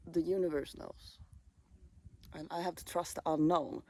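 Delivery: ordinary delivery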